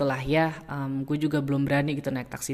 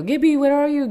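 Jo calling; 11 semitones higher, 6 dB louder than usual. Retold or imitated. Jo phones her, lost.

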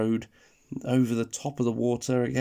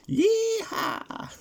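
high whooping yeehaw; excited whoop; 3 dB louder than usual. Acted. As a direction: let out a big cowboy whoop. Excited cowboy cry after the rhyme.

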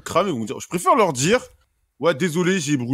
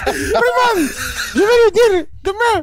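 hammed-up Ivorian mom calling school; accent ivoirien exagéré, voix aiguë; mock Ivorian accent mother; 13 semitones higher, slower than usual. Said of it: "un accent ivoirien".

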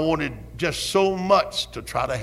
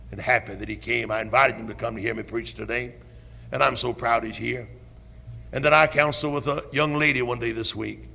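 usual speaking voice

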